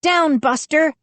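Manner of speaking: very high-pitched command; ordering. A narrator's reading. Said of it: "Mindy ordered".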